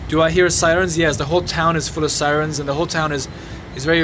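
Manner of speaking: usual speaking voice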